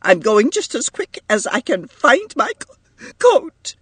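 high voice breaking on a stammer; tearful. A narrator's reading. Edith hunts for her coat to leave, tearful. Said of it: "Blind with tears".

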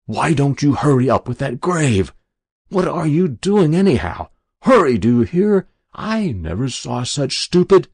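loud, high furious outburst; sudden fury. A narrator's reading. Adjutant raging at two privates digging under fire, furious. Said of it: "burst out in a sudden strange fury".

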